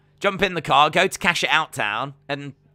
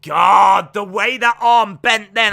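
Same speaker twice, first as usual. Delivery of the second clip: shocked outburst; 4 semitones higher, 7 dB louder, slower than usual. Real, not acted. Arm bends badly in fight.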